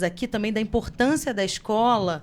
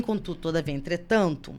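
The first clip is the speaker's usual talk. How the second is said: ordinary talk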